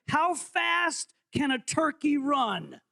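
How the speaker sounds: slow, drawn-out quiz question; quiz host. Shown as a demonstration. Host reading the quiz question slowly to the room. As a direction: stretch the question like a game show. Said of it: "quiz host reading question".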